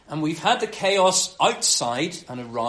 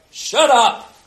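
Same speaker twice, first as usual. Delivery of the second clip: loud, raised, abrupt order; telling off; 5 semitones higher, 9 dB louder than usual. Acted. Jesus silencing a raging storm, loud and abrupt. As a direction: silence it with one forceful burst. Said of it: "storm's been raging, he's just like".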